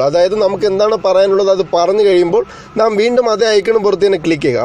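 ordinary talk, nothing marked

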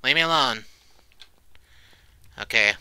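ordinary talk, nothing marked

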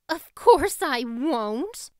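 loud, slow, indignant snap; slow, emphatic, heated refusal; flashing retort. A narrator's reading. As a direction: fire back with a flash of temper. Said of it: "flashed Anne".

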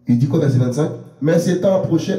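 usual speaking voice

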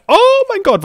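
high, loud excited cry. Real, not acted. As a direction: gasp it out in delight. Entdeckt etwas. (begeistert ausgerufen)

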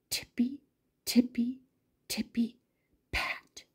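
soft sneaking-bunny footsteps; tiptoe hush. A narrator's reading. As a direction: tiptoe the words like sneaking bunnies.

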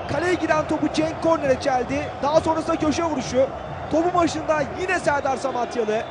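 normal talking voice

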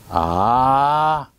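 long, steady, open-mouthed ahh; saying ahh. Shown as a demonstration. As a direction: open wide and hold ahh. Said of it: "아 소리를 내게 되면".

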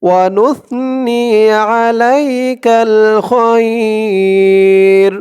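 আলাইকাল খয়র drawn out slowly; slow recitation. Shown as a demonstration. Teacher reciting the joined phrase slowly.

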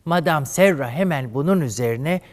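ordinary talk

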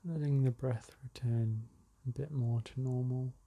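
calm, unhurried meditation guide; spacious, settled relaxation-exercise delivery; guided meditation. Acted. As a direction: ease them into slowing the breath, with long pauses.

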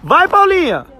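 high excited cheering. Real, not acted. Dad cheering his daughter flying her kite, high and excited. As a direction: urge her on like a fan.

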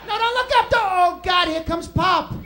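high, loud cries of dread; panicked shouting. Retold or imitated. Kids in the car seeing Pop coming, panicked shouting. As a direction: yell in mounting panic.

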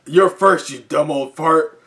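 loud high battle yell; fierce shout. Acted. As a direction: yell it as a challenge. Heroine threatens a villain.

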